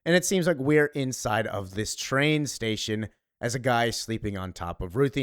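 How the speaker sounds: ordinary talk, nothing marked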